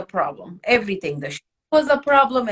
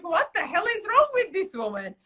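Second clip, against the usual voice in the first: higher, quick imitation of indignation; mock incredulous outburst, pitched up and fast; indignant disbelief; 6 semitones higher, 3 dB quieter, faster than usual. Client stares at the artist, indignant disbelief.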